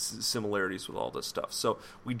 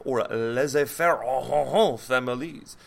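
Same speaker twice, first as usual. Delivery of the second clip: mock French accent with "oh, oh, oh"; hammed-up French flourish on "laissez-faire"; 6 dB louder, slower than usual. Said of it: "whenever I say French word, I must say with French accent".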